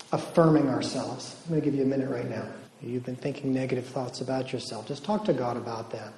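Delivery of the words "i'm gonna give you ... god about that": hushed, gentle prayer-leading voice; soft guided prayer. Acted. Retreat speaker to the women, hushed guided prayer. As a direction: lead them quietly into talking with God.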